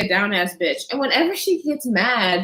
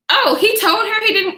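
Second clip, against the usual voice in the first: ordinary talk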